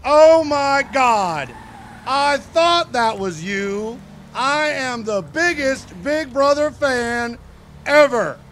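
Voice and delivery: loud, slow, drawn-out fangirl squeal; hammed-up excited superfan; gushing superfan. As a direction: gush like she just met her idol. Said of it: "was like".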